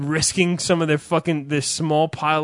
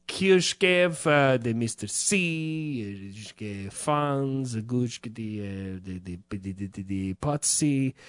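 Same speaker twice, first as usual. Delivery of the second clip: mock foreign gibberish; lightly faked Slavic-sounding names; fake Eastern European; 4 semitones lower, 6 dB quieter, slower than usual. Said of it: "fucking Eastern Europeans that just".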